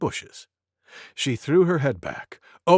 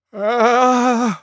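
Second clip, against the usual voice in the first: pre-sneeze build; 8 semitones higher, 7 dB louder than usual. Acted. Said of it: "went Elephant".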